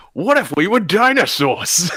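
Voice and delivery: put-on Brooklyn accent. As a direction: imitate the narrator's Brooklyn voice. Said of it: "Putting on that fantastic Brooklyn accent".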